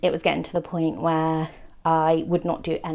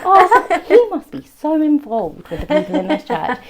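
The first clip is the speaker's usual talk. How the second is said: high, loud naive admiration; mock gushing; 10 semitones higher, 8 dB louder than usual. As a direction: coo over it, pitched way up. Retold naive admiration of involved dad.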